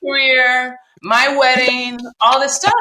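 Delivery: loud, hammed-up furious tirade, slow; furious rant. Woman retelling how she raged at her boyfriend, loud mock fury. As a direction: rail at him, half laughing at yourself. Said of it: "I was beside myself".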